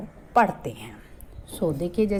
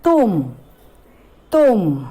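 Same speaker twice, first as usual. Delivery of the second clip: louder, each कुल called out; clear drilled word; 6 dB louder, slower than usual. Shown as a demonstration. Teacher modelling a word for children, loud. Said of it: "छोटे ऊ की मात्रा लगाएंगे".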